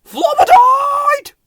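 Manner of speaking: very high, slow, loud scared cry; high drawn-out panicked shout; frightened shout. Acted. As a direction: wail out in fear. Both frightened, shouting together.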